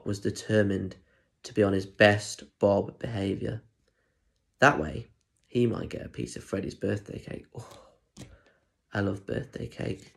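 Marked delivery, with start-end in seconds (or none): none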